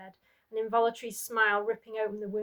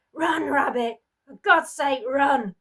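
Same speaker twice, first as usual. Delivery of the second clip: desperate scream; 5 semitones higher, 12 dB louder, slower than usual. Acted. Mother shouting for child to flee.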